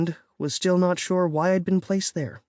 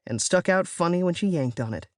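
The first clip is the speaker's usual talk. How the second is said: plain reading voice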